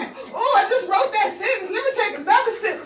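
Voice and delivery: high, loud, giddy mock-tipsy writer; playful imagined writer. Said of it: "you was like".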